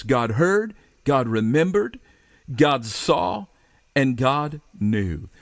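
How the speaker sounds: each "God heard", "God remembered", "God saw", "God knew" set apart, the last slowed; slow deliberate stress on "and God knew"; emphatic list. Shown as a demonstration. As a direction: land every two-word phrase with weight. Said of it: "Look at this".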